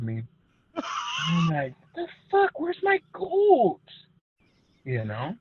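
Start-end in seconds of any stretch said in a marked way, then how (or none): 1.9-3.8 much higher, slow, baffled cry; confused outburst. Retold or imitated. Boy searching for his missing goat, high and drawn out. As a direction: stretch it out in high-pitched disbelief.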